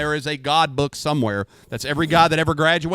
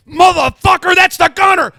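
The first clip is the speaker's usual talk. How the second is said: much higher, loud retold sergeant yell; 13 semitones higher, 10 dB louder than usual. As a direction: bellow it like a shocked sergeant. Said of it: "some guy like Sergeant Bodette's like".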